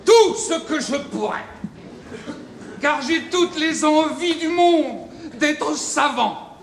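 high, loud, slow declamation; theatrical, wholehearted enthusiasm; eager outburst. Acted. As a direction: proclaim a great hunger for learning. Pupil eagerly begs to learn.

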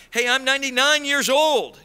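usual speaking voice

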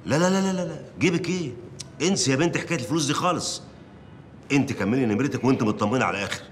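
high, rapid-fire dismissal then insistence; eager reassurance. Acted. Editor waving away the intern's money worries, eagerly. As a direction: cut in eagerly, pitched right up.

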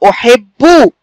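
"أحب" as "u-hib-bu", double b held; doubled b demonstration. Shown as a demonstration. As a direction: show the doubled b in "أحب" with a firm final u. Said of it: "هكذا".

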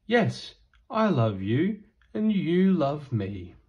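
plain reading voice

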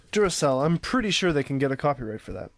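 ordinary talk, nothing marked

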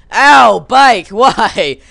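loud, high wail of protest; mock agonized yell. Rider hit by his own bike, mock agonized yell. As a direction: yell out in hammed-up pain.

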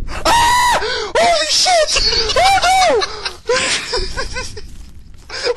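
real, very high, loud shriek of fright; screaming after turning around to see Slender. Real, not acted. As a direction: shriek like you've been jumped. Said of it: "I just screamed like a girl".

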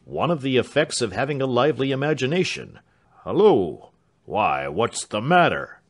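loud manservant breaking off in surprise; Mr Britain, hearty then startled. Acted.

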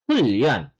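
"Hulyeon" low, with a held L; correct assimilated pronunciation. Shown as a demonstration. Korean teacher repeating the right form, assimilated pronunciation. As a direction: run "Hulyeon" together as hul-lyeon. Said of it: "yung tamang pronunciation is".

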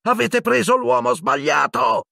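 high, strained protesting shout. A narrator's reading. Harry telling the minister of the mistake, shouting. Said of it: "urlò Harry".

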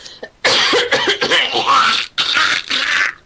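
deliberate throat-clearing cough. Real, not acted. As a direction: clear your throat before reading. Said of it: "I've cleaned my throat".

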